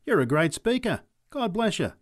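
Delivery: high, loud approval; encouraging cheer. A narrator's reading. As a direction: cheer the speaker on. Woman cheering on the preacher.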